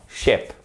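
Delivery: "ship" with a short, lax i made at the back; short vowel. Shown as a demonstration. Teacher corrects the mistake, "ship" with a short lax i. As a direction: cut the i of "ship" short and relaxed. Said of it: "Vous allez vers l'arrière".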